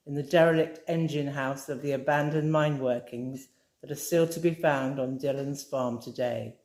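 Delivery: plain reading voice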